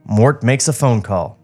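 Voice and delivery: usual speaking voice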